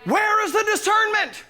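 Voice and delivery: high, loud pulpit cry; full-force preaching. Real, not acted. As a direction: challenge the congregation at full voice.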